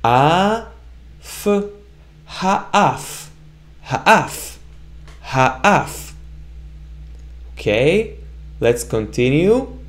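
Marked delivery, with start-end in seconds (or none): 0.0-6.1 "ha, af" sounded slowly part by part with a clear f; syllable by syllable. Shown as a demonstration. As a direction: build "ha, af" from its parts. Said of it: "So all together it's".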